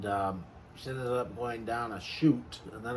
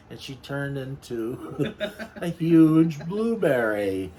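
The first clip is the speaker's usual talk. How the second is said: talking through laughter; giggling through the words; laughing; 3 semitones higher, 7 dB louder than usual. Real, not acted. Laughing while telling blueberry scene.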